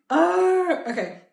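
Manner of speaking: very high, loud "oh" settling down; startled oh. Real, not acted. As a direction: squeal it then calm down. Recovers from laughing.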